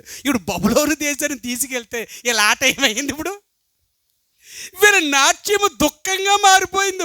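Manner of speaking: high, loud pulpit preaching; fiery preaching. Acted. Preacher at full force. (ఉద్రేకంతో బిగ్గరగా ప్రసంగం)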